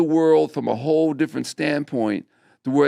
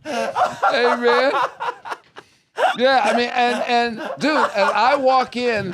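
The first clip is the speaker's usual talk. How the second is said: laughing; 8 semitones higher, 5 dB louder, slower than usual. Real, not acted. Still laughing after the joke.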